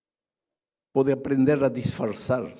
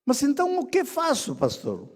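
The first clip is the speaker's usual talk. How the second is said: high, helpless plea; a believer begging the pastor for help; pleading congregant; 11 semitones higher than usual. Retold or imitated. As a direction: mimic the lost believer asking. Pastor voices congregant asking.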